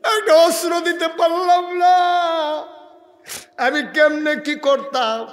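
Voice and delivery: high, loud chanted lament; crying wail. Acted. Prophet has no weapon to give, loud tearful wail. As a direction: cry it out in long drawn notes. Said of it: "নবীজি কান্দে".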